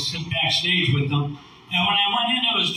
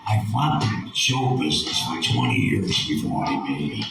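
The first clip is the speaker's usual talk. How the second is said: deep veteran entertainer impression; low-pitched showbiz elder talking to a kid; George Burns; 11 semitones lower than usual. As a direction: sink very low, imitating an old comic. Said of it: "and he goes".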